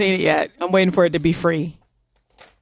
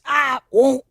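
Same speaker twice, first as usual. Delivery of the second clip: higher-pitched mock Southern accent; hammed-up Southern accent; 5 semitones higher than usual. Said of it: "say it with a Southern accent".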